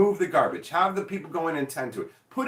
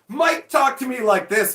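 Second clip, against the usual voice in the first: higher, louder, slower whine; whiny complaining customer; 8 semitones higher, 7 dB louder than usual. Retold or imitated. Offended customer complaining to the owner about Mike, whiny. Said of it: "be like".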